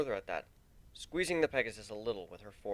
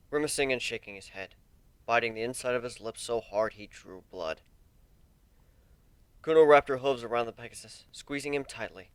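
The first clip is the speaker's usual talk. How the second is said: ordinary delivery, nothing marked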